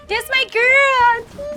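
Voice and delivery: high, drawn-out excited cheer. Real, not acted. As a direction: gush it up high and slow. Excited praise of best friend.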